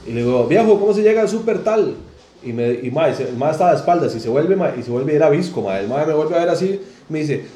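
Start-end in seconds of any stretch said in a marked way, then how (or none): 0.5-1.9 raised, higher-pitched directions question; calling to a stranger. Retold or imitated. Asking a man on the corner for the supermarket, raised calling voice. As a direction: call out politely to someone on the corner.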